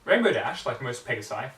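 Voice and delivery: ordinary delivery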